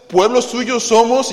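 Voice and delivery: loud, high, slow declamation; leading scripture reading. Real, not acted. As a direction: proclaim it for all to follow. Leading congregation in reading psalm.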